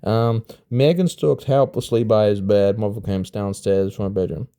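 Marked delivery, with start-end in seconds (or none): none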